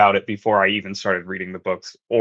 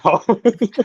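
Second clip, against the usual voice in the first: laugh; 9 semitones higher than usual. Real, not acted. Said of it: "Sam chapter can vote now".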